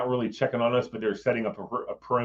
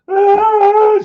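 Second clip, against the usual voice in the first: loud, high mock scream, far louder; panicked scream; 14 dB louder than usual. Imitating a frightened lady in the street, a loud high scream. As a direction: shriek it like a terrified woman.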